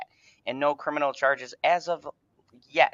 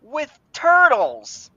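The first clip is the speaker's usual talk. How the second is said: high-pitched, theatrical announcement; high voice, slowing on the punchline; incredulous headline reveal; 12 semitones higher, 3 dB louder than usual. Acted. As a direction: build up to an absurd reveal in a high voice. Theatrical reveal of absurd article headline.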